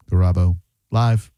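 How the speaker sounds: GARABO LIVE broken up, low and loud; low, deliberate GARABO LIVE; stress test on Garabo. Shown as a demonstration. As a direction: push each part of GARABO LIVE out separately. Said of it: "putting the emphasis on".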